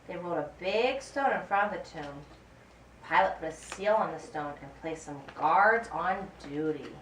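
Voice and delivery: usual speaking voice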